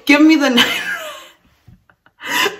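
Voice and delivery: breaking up mid-quote into high laughter; talking through helpless laughter; laughing. Real, not acted. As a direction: lose the line to a real giggle. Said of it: "I should be laughing".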